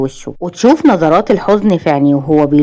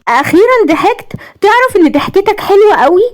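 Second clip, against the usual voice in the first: high-pitched gleeful exclamation; delighted, high and girlish; happy delight; 13 semitones higher than usual. A narrator's reading. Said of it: "اللي ابتسمت وسقفت بسعادة". (بسعادة وفرحة)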